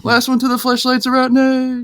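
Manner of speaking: high, hammed-up yell while sprinting; mock yell at a crowd through the doors; playful shouted rallying cry.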